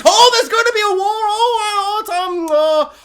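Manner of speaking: fake-cry over the coming war; old lady wailing insincerely about war; mock sobbing. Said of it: "she's all like".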